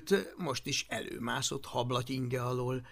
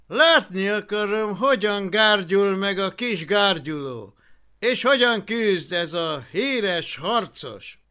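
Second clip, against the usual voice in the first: bored consul voice; 6 semitones higher, 10 dB louder than usual. A narrator's reading. Consul continues speaking.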